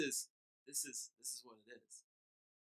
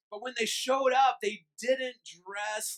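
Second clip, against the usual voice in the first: loud, high preaching push; preaching at full force; 10 semitones higher, 17 dB louder, much slower than usual. Acted. Pastor preaching to his church, loud and high. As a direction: drive it home to the congregation.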